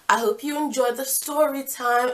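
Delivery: ordinary talk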